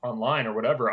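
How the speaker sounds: ordinary talk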